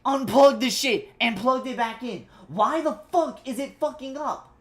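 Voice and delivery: loud, exasperated complaint; snapping at the broken cable; real irritation; frustrated outburst. Real, not acted. Streamer's cable keeps failing.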